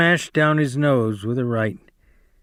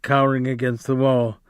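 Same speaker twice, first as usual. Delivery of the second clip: ordinary delivery, nothing marked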